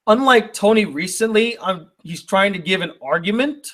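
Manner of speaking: indignant repeat rising into a question at "argument"; incredulous echo. Real, not acted. YouTuber repeating a critic's jab, incredulous. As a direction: throw the words back in disbelief, ending "argument" on a rise. Repeats letter's line in disbelief.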